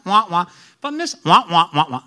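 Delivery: Charlie Brown teacher's trombone voice; droning adult gibberish, wah wah; muffled wah wah talk. Retold or imitated. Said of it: "Did you ever know Charlie Brown?".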